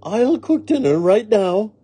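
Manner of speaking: high, eager hostess; Missus Rogers hurrying to oblige. A narrator's reading. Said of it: "said Missus Rogers".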